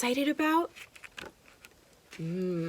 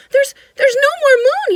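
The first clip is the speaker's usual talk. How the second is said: put-on upset child; 19 dB louder than usual. Yasmin upset the moon is gone.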